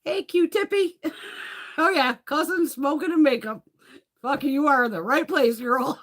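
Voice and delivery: high, loud, bubbly welcome; light up for a new friend; greeting a new viewer with excited laughter. Real, not acted.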